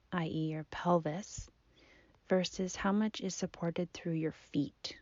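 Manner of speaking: soft, unhurried meditation voice with long pauses; guided body scan. Acted. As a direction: gently guide the listener's attention through the body. Leads a seated body scan.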